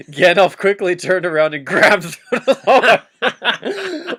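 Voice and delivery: slow, laughing through the line; laughing dramatic read. Acted. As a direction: struggle to read it without laughing. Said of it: "Wow. He, Gandalf, you exceeded expectations".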